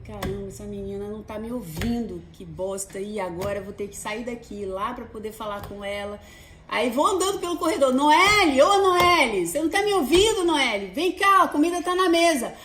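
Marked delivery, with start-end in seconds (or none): 0.1-6.2 soft annoyed grumble under the breath; muttering to herself. Retold or imitated. Mother annoyed the daughter won't answer, muttering softly. 7.9-12.5 loud, higher calling down the hall. Retold or imitated. Mother walking to daughter's room, calling loudly.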